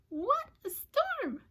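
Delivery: high, slow bird voice; twittering wren. Acted. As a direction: chirp it like an excited little bird. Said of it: "twitters wren".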